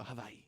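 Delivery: soft "Hawaii" with a v sound in place of the w; correcting local pronunciation. Retold or imitated. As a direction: quietly correct it to "Hawaii", w turned to v. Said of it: "they correct you".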